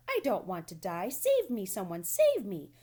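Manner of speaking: panicked piglet wailing; terrified pleading. A narrator's reading. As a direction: beg for rescue at the top of your lungs. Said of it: "screamed Wilbur".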